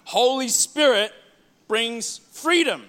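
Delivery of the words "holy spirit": normal talking voice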